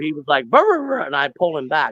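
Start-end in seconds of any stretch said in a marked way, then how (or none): 0.5-1.0 high repeated dog growls "brr, brr, brr"; imitated dog growls. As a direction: mimic a dog snapping three times.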